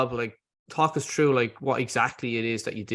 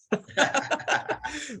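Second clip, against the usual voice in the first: high laugh; 13 semitones higher, 4 dB quieter than usual. Real, not acted. Laughing at the Irish accent remark.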